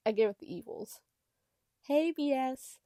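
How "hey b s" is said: high, sweet voice for a cat; cutesy voice. A narrator's reading. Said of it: "Terrell says in a cutesy voice".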